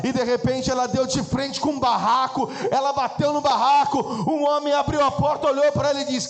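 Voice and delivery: high and loud fervent narration; preaching at full force. Acted. Preacher telling the church of the walk through the slum, shouting. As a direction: drive the story home at full force. Sustained climax of story.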